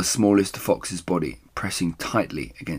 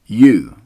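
loud pointed "You"; single him out; emphatic; 3 semitones higher, 12 dB louder than usual. Acted. Mister Fox turns on Rat, pointed and loud. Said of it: "said Mister Fox".

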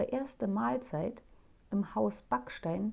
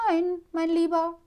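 higher, louder fond wife's voice; kindly wife voice; gentle wife; 9 semitones higher, 6 dB louder than usual. Acted. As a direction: soothe your husband. Wife's voice in story.

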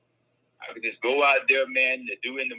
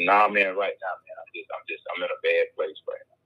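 downcast neighbor; 7 semitones lower than usual. Retold or imitated. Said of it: "He said".

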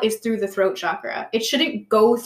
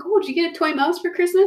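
sweet pet talk; 8 semitones higher than usual. Retold or imitated. Talking to the family's cat.

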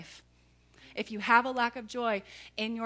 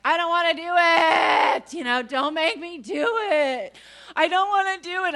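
whiny tween complaint; 7 semitones higher, 11 dB louder than usual. Retold or imitated. Tween whining to her dad about the early fishing trip, shouted. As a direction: whine it like a sulky eleven-year-old refusing to go. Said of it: "I'm grumbling about it and complaining about it".

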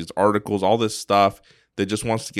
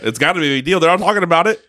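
mock alarm, pitched up; mock outrage; 8 semitones higher, 4 dB louder, much faster than usual. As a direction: play the hyped-up panic. Mocking media outrage.

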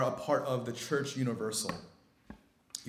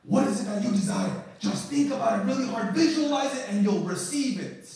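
loud, raised-pitch motivational pitch; charismatic self-help speaker; 10 semitones higher, 10 dB louder than usual. Retold or imitated. Preacher shows how he could tell people, in a mock charismatic guru voice. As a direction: work the crowd like a mock guru. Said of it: "these guys that are very charismatic".

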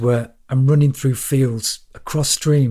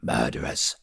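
low accusation; 10 semitones lower, 7 dB quieter than usual. A narrator's reading. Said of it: "He shook the bags of stale bread".

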